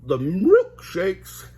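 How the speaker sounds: loud slow dog-speak; loud drawn-out Scooby voice. Acted. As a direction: bark out the milkshake order. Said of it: "added Scooby".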